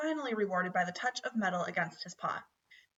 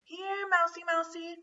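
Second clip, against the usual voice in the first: high sing-song call for the mouse; 8 semitones higher than usual. A narrator's reading. Lena calling for the missing mouse, high calling out. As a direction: call out to a hidden pet. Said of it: "called Lena".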